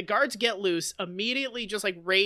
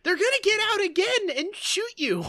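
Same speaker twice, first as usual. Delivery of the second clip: high, raised voice of disbelief; exasperated; 10 semitones higher than usual. Real, not acted. Podcaster fuming at the heroes, high exasperated rant. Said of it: "exasperated at the characters".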